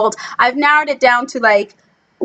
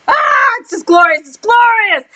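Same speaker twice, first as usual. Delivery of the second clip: high-pitched fan squeal; ecstatic gushing; 10 semitones higher, 3 dB louder than usual. Real, not acted. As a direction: gush in a very high, thrilled voice. Gushing about the scene.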